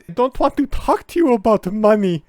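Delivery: high, loud mock diva; shrill it out in mock panic; mock meltdown. Imitating the meltdown, high and loud. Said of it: "that meltdown".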